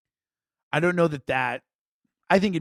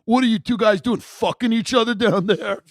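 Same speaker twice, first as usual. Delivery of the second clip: high, loud jeering big brother; mocking older brother; 7 semitones higher, 7 dB louder than usual. Older brother taunting the kids in the basement, loud jeering yell. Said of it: "his older brother would be like".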